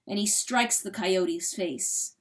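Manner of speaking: plain reading voice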